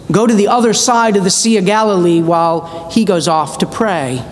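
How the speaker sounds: normal talking voice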